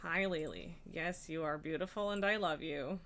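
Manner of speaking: soft tender pet talk. Real, not acted. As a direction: lovingly reassure a pet. Talking tenderly to cat Lily.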